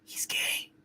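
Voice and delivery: hushed aside; whispered under the breath. Real, not acted.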